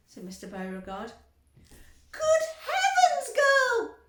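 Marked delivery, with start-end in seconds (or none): 2.1-4.0 very high, loud, slow screech; mother screeching at Violet. A narrator's reading. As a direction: screech in horror. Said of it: "screeched Missus Beauregard".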